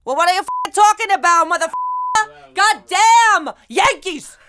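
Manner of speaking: high-pitched, hammed-up indignant outburst; loud American rant. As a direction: yell it like a brash American. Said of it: "bájale dos al f".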